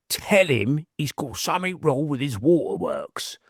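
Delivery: put-on slow Cockney London accent; drawn-out Cockney, as a voice heard in the head; Uncle Albert. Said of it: "just like Uncle Albert out of Only Fools and Horses".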